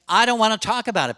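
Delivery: high, loud, fast and irritated; angry refusal. Retold or imitated. Older man refusing to discuss his health with the therapist, angry. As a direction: snap back to shut the talk down. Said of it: "Then they get mad".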